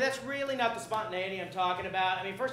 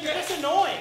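exasperated; 5 semitones higher, 7 dB louder than usual. Acted. Anchor fed up.

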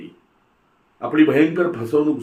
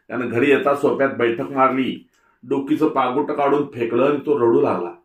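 usual speaking voice

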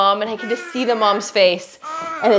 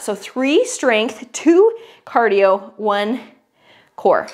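slow and deliberate; 2 semitones lower, much slower than usual. Shown as a demonstration. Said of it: "spells out the format".

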